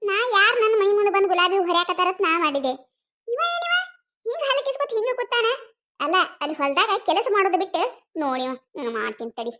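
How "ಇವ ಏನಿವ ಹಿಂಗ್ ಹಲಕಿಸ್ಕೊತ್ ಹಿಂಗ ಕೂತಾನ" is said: shocked. Acted. Wife shocked at husband.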